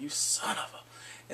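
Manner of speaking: slow whispered curse under the breath; muttered curse. Retold or imitated. Viewer cursing the husband, slow whisper. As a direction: hiss the insult out slowly, barely voiced.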